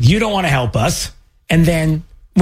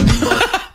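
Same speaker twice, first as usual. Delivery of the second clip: short breathy laugh burst; sudden real laugh; burst of laughter. Real, not acted. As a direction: laugh out at the line. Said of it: "that's the part that made me laugh".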